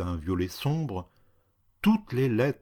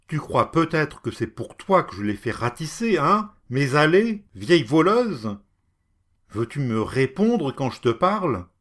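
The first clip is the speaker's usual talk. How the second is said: higher and louder, contemptuous; angry scolding; 5 semitones higher, 5 dB louder than usual. Acted. Cat berating the woman over his paths.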